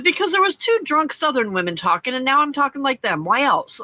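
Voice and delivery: high-pitched Southern comeback; exaggerated Southern accent. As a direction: retell it in a hammed-up Southern twang. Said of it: "I was talking Southern all over the place".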